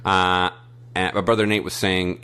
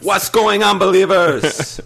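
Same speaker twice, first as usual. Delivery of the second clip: much higher, louder, slow put-on greeting with an accent; terrible Vampiro impression; 12 semitones higher, 7 dB louder, slower than usual. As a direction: greet the believers as a bad Vampiro. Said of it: "That was a terrible Vampiro impression".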